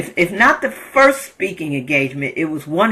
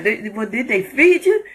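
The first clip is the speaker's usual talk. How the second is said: voicing the truck driver; 5 semitones higher than usual. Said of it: "this is the truck driver asking me".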